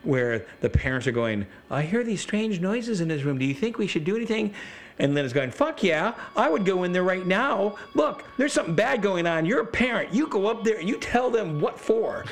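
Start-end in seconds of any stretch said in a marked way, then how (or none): 1.7-4.5 high-pitched, timid parent voice; hammed-up fretting in a high voice; meek worried parents. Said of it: "they're just very meek". 5.5-12.2 high, emphatic mock indignation; fired-up scolding of timid parents; indignant urging. Wife's indignant heckling of the parents.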